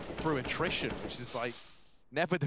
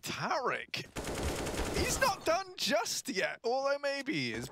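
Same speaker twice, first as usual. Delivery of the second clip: much higher, slow hyped call; excited shout; 11 semitones higher, slower than usual. Real, not acted. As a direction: cheer the player's name out. Caster hypes a clutch.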